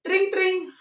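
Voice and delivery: high-pitched sing-out phone ring; mock phone ring. Storyteller places the radio station's call, imitated phone ring. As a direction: imitate a ringing telephone.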